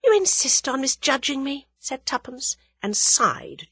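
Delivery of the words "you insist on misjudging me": high, mildly reproachful voice; innocent heroine. A narrator's reading. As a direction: protest your innocence in a high voice. Heroine feigns hurt innocence.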